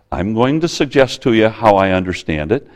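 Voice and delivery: ordinary talk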